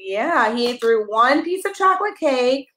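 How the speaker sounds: ordinary delivery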